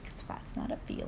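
soft, barely voiced restatement; hushed aside. Real, not acted. Quietly restating the slogan to a friend, hushed. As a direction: say it low, almost under your breath. Same slogan repeated softly, sincerely.

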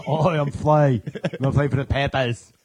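slow, loud put-on drawl, half laughing. Hosts joking as [name] with a silly accent, Aussie Forrest Gump voice. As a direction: play dumb and drawl it out. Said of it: "I don't know why I sound Forrest Gump".

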